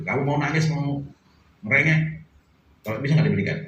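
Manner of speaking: sedikit lebih keras, nada mengejek; orang tua kasar mengejek. Retold or imitated. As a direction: ejek anak yang merengek dengan nada kasar. Harsh parent mocking child. (orang tua kasar menyindir anak yang mau menangis)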